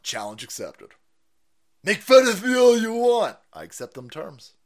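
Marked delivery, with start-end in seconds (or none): 1.8-3.4 higher, louder put-on voice; mock influencer voice. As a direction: ham up the influencer's taunt. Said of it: "quoting the influencer".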